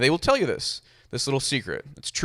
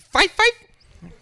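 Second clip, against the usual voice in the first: tiny, very high mock voice; stupid-sounding answer; 4 dB louder than usual. Short man admitting his height to a friend, silly high voice. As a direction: squeak it out far above your own pitch. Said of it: "it sounds stupid".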